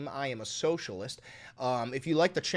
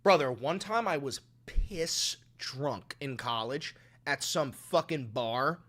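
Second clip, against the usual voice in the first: ordinary talk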